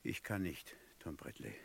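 barely able to get it out; broken. Acted. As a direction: judge refusing, voice collapsing. Judge breaks down.

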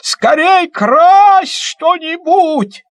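high, loud, slow yelling; excited shout. Acted. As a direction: yell it in excited glee. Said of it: "как закричит".

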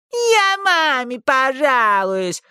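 mock the whiny boy back; squeaky mimicry. A narrator's reading. Housekeeper mocks the boy's threat in a squeaky, slow voice. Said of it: "пискляво передразнила его Эсси Ли".

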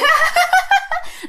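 very high, rapid witchy cackle; imitated ghost cackle. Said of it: "Đó, bà cười vậy đó". (giả tiếng ma cười the thé)

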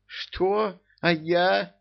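high-pitched indignant protest. A narrator's reading. Jasmin hears she is left out of heirs, indignant. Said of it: "возмутилась Жасмин".

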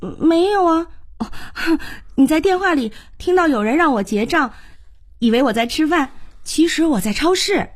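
high-pitched innocent denial; eager youngster fibbing. Acted. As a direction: explain it away in a high, youthful voice. Plays Xiaoke fibbing to Haichao.

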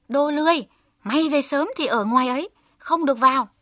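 high, loud, fast angry scolding; scolding sister-in-law. A narrator's reading. Sister-in-law shuts Hơ Lao out: loud, fast furious scolding. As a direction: bark at the lazy boy shut outside. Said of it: "còn chửi mắng như tát nước vào mặt".

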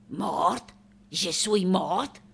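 soft, slowing disbelief; hushed, bewildered echo; stunned question. Acted. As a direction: ask it quietly, taken aback. Artist learns she is dead.

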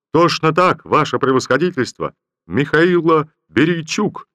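soldier's cheerful loud reply to the general; cheerful shout. Acted. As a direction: bellow the answer with a childlike grin. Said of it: "весело, с довольной детской улыбкой крикнул солдат".

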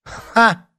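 high, loud single scoff; high-pitched defiant "ha"; scornful ha. A narrator's reading. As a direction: fling back a loud mocking laugh-word. Scornful retort through door.

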